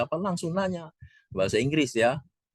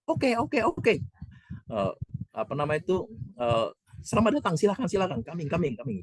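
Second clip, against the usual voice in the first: much higher, cheerful ushering; eager welcome; 10 semitones higher than usual. Retold or imitated. Second guard welcomes them. (mempersilakan dengan semangat, suara sangat tinggi)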